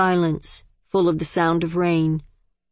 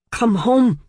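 louder, higher, voice trembling; father begging his daughter, voice shaking; 6 semitones higher, 4 dB louder than usual. A narrator's reading. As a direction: plead while barely holding control. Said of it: "His voice shook".

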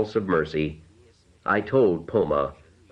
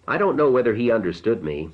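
ordinary delivery

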